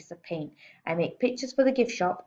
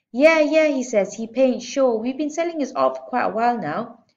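higher, louder salesman; boastful; 6 semitones higher, 7 dB louder than usual. A narrator's reading. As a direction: brag to the caller. Manager boasting on phone.

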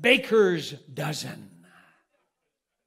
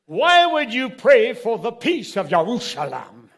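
raised, louder imitation of an incredulous rabbi; challenging rabbi; 5 semitones higher, 7 dB louder than usual. Rabbi confronts a praying Gentile in the street, challenging. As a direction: act out a rabbi's pointed question.

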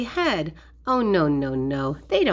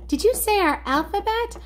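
much higher, eager questioning voice; curious toy character; 10 semitones higher, faster than usual. Pretend. Toy friend asks about singing the alphabet, high excited question. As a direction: pipe up high, rising at the end.

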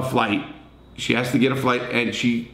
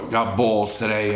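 ordinary talk

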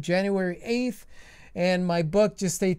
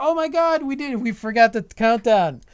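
excited; 5 semitones higher, 7 dB louder than usual. Real, not acted. Realizing it's midnight, excited.

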